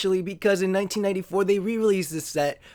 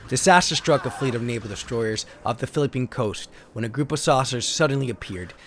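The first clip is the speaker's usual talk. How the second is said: lower, steady storybook narrator voice; story narration; 8 semitones lower than usual. Acted. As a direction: read the card like an old sci-fi serial. Continues card story narration.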